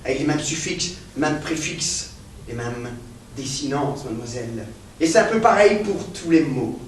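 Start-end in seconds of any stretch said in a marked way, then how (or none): 0.0-4.7 much softer and lower, fading away; professor's lecture trailing off to a hush; sinking murmur. Acted. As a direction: let the voice drop almost to nothing.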